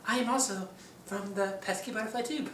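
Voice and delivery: high, far-off puppet voice; high cartoon puppet voice; puppet character voice. Shown as a demonstration. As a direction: pitch way up into a little puppet.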